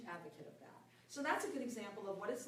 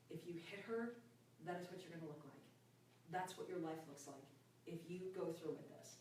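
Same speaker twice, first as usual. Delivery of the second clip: quiet inner voice; 4 semitones lower, 7 dB quieter than usual. Retold or imitated. Inner thought on stairs.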